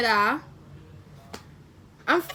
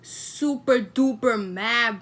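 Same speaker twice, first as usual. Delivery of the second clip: mock mad; 3 semitones lower, slower than usual. Real, not acted. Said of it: "I'm getting".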